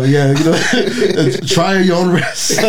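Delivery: slow, high, laughing through words; a joke disclaimer, laughing through it. Real, not acted. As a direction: giggle out the warning.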